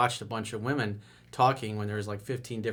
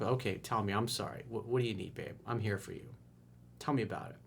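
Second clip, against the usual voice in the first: softer, gentle mock reassurance; soothing boyfriend; 7 dB quieter, faster than usual. Boyfriend reassuring his upset girlfriend, softly.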